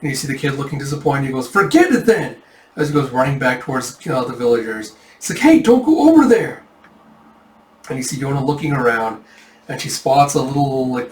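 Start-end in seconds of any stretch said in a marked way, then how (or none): 1.5-2.3 high, loud, dismissive retort; fed-up kid. Acted. Disappointed kid to frozen Yona, high huffy retort. 5.4-6.6 high, loud warning shout; calling out. Acted. Villager to kid running back, high warning shout.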